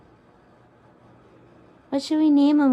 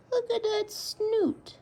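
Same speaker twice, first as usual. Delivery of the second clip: slow cooing; 7 semitones higher, slower than usual. Real, not acted. Cooing repeated.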